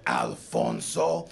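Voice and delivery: very deep, drawn-out name; exaggerated macho bass, slow; mocking name pronunciation. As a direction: taunt with the name in a huge low voice. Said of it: "Why are you saying my name like that?".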